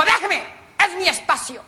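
high-pitched mock indignation; hammed-up touchy spouse; indignant wife. Wife voiced snapping at husband.

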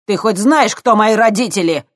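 loud, high challenge; defiant shout. Acted. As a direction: shout it like a spoiled threat. Kostya scowling, threatening.

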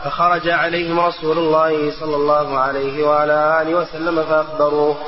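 ordinary delivery

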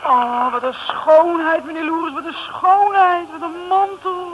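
slow, loud, rising rapture; swooning delight. Acted. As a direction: gush over the coat. Swooning over the coat. (verrukte uitroep over de mantel)

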